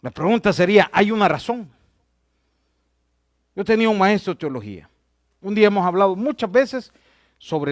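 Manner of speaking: forceful pulpit teaching with punched key words; emphatic preaching. Real, not acted. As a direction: press the point home to a congregation.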